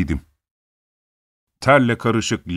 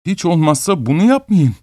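higher, tearful plea; pleading, near tears; 7 semitones higher than usual. A narrator's reading. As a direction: beg him like you're about to cry. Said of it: "Ağlar gibi bir sesle".

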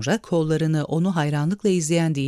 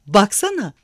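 a loud, higher, delighted call; joyful shout; 5 semitones higher, 6 dB louder than usual. Acted. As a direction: shout it out with glee. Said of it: "diye sevinçle bağırdı". (sevinçle bağırarak)

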